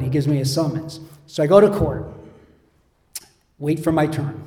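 normal talking voice

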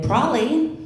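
high, sharp "Probably"; curt reply. Retold or imitated. Mom answering her daughter, high and curt. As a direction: fling it back at her.

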